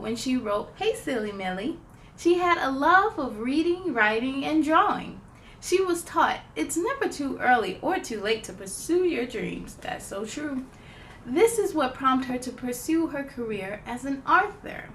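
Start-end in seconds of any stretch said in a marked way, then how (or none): none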